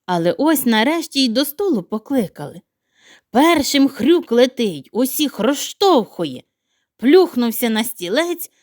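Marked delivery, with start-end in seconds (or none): none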